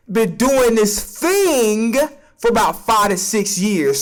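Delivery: ordinary talk, nothing marked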